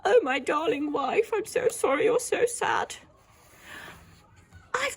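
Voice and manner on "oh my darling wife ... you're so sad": higher, tender apologetic voice; tender husband. Shown as a demonstration. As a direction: comfort his sad wife. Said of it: "Wonder what Mister Wobble's thinking".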